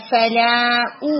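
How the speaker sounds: فلا او drawn out with a strong damma; teacher shows the damma in فلا او; slow tajweed demo. Shown as a demonstration. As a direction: stretch فلا او slowly. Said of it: "یہاں ضمہ تھوڑا سا آپ کا ویک ہوا تھا".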